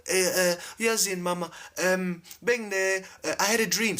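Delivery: put-on high-pitched, hesitant young person's voice; child to mom. Child telling mom about a dream, high and hesitant. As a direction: play someone haltingly confiding, pitched well up. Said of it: "You go to your mom".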